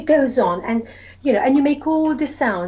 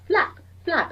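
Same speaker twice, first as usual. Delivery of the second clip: higher playful flap flap; flapping sound; 7 semitones higher than usual. Shown as a demonstration. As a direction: make the bird flap for the kids. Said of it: "So go".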